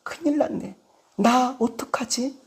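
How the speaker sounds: hushed, acted dismay; quiet voice of someone at a loss; worried muttering. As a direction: fret softly to yourself. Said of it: "또 말합니다".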